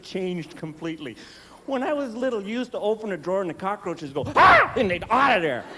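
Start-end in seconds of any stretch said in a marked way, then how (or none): 4.4-4.7 tiny high panicked scream; cockroach shriek. Pretend. Cockroach screams as the drawer opens, high shriek. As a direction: shriek like a fleeing bug.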